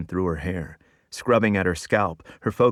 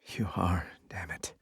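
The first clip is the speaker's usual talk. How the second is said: hushed, half-whispered groan; groaned admission; 10 dB quieter than usual. A narrator's reading. Boss giving in to her assistant's teasing, groaning softly. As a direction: concede it under the breath. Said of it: "She groans".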